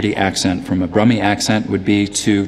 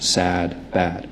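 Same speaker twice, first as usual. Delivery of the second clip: softer, lower, unraised Philadelphia vowel; Philadelphia accent; 4 semitones lower, 6 dB quieter than usual. Shown as a demonstration. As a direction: open Philadelphia "a" in "sad" and "bad". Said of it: "something more open like my own".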